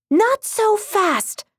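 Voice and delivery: high, slow heroic princess voice; cartoon princess. A narrator's reading. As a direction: draw it out like a bold superhero.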